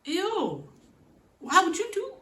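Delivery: high grossed-out groan; disgusted ew. Real, not acted. Fan disgusted by the scene, high. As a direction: recoil at the scene.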